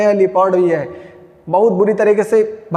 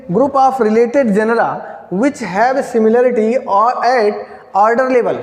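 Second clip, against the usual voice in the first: slow dictation pace; much slower than usual. Shown as a demonstration. Teacher dictating a definition to students, slowly. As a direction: spell out group of related genera word by word. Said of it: "अगर हम definition लिख लें".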